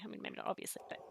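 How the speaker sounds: sudden whisper under the breath; hushed afterthought; whispered aside. Real, not acted. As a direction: drop to a whisper for a sly correction. Whispered aside to stream chat.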